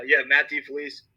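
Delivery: strained voice while choking. Real, not acted. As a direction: push the words out through a choke. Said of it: "Sorry, I'm choking right now".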